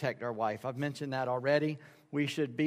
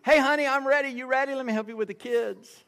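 much higher, hammed-up eagerness; eager helpful husband; 10 semitones higher, 6 dB louder than usual. Husband offering his wife help before church, with acted eagerness. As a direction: chirp it loudly, then ease into a helpful offer.

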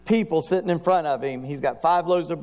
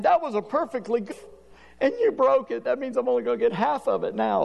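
pitched-up mock grumble; whiny complaint; 8 semitones higher than usual. Believer grumbling to God about his share, pitched-up mock sulk. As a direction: sulk over getting half.

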